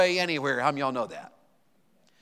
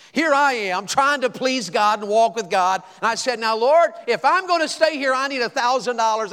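raised, high pleading to the Lord; pleading prayer; 7 semitones higher, 6 dB louder than usual. Retold or imitated. Preacher reenacting his desperate prayer, raised and pleading. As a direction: cry out to God for the money, louder.

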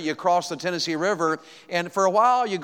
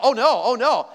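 higher, louder "Oh, no" of alarm; dismay; 7 semitones higher, 6 dB louder than usual. Retold or imitated. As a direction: gasp it out as the fuel gauge hits zero. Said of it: "and I thought".

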